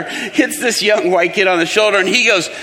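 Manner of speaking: ordinary delivery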